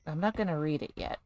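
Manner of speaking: normal talking voice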